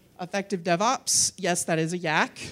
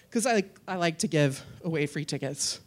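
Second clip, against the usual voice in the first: ordinary talk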